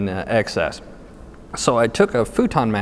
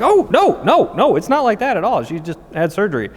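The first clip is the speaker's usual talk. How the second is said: flustered mock protest; 10 semitones higher than usual. Husband reassuring the desk his wife only had surgery, comically flustered. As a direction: rush to correct them in acted alarm. Retold hasty correction of misunderstanding.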